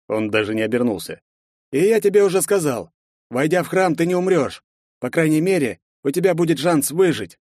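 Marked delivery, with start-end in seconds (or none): 1.7-7.4 raised, lively character voice. Acted. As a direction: speak as the stranger. Character answers the hero.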